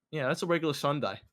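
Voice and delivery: ordinary talk, nothing marked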